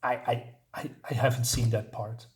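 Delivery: ordinary talk, nothing marked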